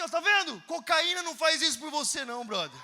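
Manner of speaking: loud, slow final line; triumphant loud preaching. Retold or imitated. Preacher telling the dealer only Jesus, triumphant shout. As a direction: proclaim it triumphantly.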